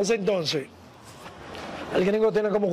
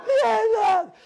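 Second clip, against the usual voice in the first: high-pitched imitated yell in a put-on voice; mock high holler; gringo's shout; 12 semitones higher, 3 dB louder than usual. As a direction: yell it far above your usual pitch, as the gringo. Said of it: "el gringo empezó a gritar".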